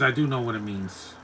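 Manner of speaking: usual speaking voice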